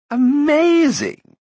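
very high, slow, loud marvel; exclaimed. A narrator's reading. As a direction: stretch out the wonder. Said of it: "exclaimed the prince".

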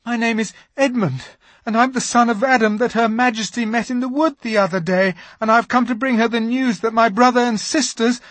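put-on high, eager boy's voice, rushing; a nervous boy blurting his news; young Edmund talking fast; anxious Edmund. A narrator's reading. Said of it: "trembling so that he could hardly speak".

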